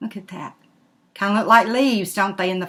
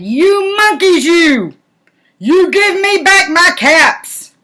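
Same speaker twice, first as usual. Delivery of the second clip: much higher, louder, slowed angry demand; angry peddler; 8 semitones higher, 7 dB louder, slower than usual. Acted. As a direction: really lose patience with them. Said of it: "This made the peddler very angry".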